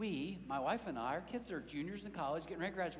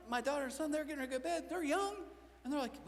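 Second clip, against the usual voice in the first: normal talking voice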